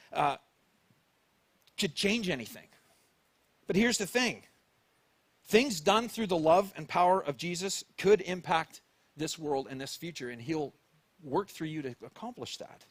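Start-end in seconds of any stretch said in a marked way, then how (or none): none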